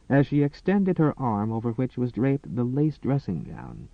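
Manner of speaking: usual speaking voice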